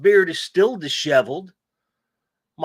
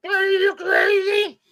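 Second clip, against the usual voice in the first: very high, slow exaggerated shriek; hammed-up high yell; acted outburst; 4 dB louder, slower than usual. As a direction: cry it out high and stretched in mock disbelief. Acted movie-style quote.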